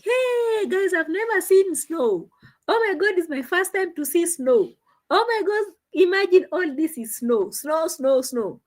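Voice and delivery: high-pitched, gushing mock excitement; excited Nasto. Imitating a man seeing his first snow, high and excited. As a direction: imitate someone thrilled, pitch jumping up on each exclamation. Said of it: "Nasto was so excited".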